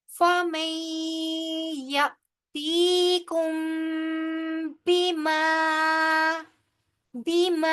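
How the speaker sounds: बिमा with the ma lengthened six counts; slow chanted recitation; tajweed recitation. Shown as a demonstration. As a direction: draw out बिमा long. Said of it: "बिमा अब क्यों हो रहा है".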